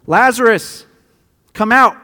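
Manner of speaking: calling out loud and high to the tomb; loud cry. Acted. Jesus calling Lazarus out of the tomb, loud cry. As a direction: cry it out with full voice. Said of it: "he cried out with a loud voice".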